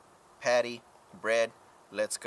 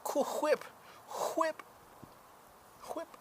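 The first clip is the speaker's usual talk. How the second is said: Family Guy quote; 4 dB quieter, slower than usual. Retold or imitated. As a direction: squeak it like the cartoon bit. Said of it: "that Family Guy episode".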